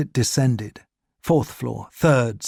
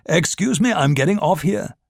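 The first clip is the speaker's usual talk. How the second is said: higher, strained excuse; Gestapo man's voice; 5 semitones higher than usual. A narrator's reading. Gestapo officer pushing through shoppers, higher strained. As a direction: push higher and tense. Gestapo man trying to leave.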